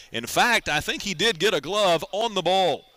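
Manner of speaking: ordinary talk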